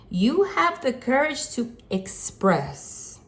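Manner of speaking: express stretched slow and lifted high; emphasis on express. Shown as a demonstration. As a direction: lean hard into express, drawing it out slow and high. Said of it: "I specifically emphasized the word expression".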